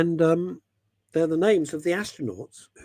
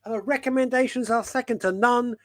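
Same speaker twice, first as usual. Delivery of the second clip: rhyming introduction; 7 semitones higher than usual. Pretend. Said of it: "I think that's probably a haiku".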